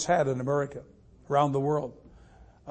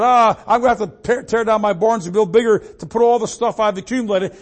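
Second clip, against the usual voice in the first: higher, louder self-satisfied fool, lightly voiced; boastful fool; 6 semitones higher, 7 dB louder than usual. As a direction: play a rich fool's boasting, lightly acted. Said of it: "like the fool who said".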